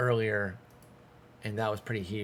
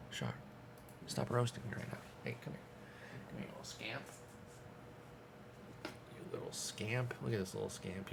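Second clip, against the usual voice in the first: soft pet talk; 12 dB quieter, faster than usual. Real, not acted. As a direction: coo at the little scamp, much softer. Talking softly to his pet.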